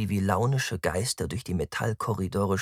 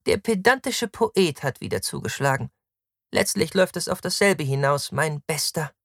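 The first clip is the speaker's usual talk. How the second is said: high-pitched woman's needling; haughty, high female character; scornful Victra, mocking; 9 semitones higher, 4 dB louder than usual. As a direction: tease the pedant in a high voice. Narrator voices Victra.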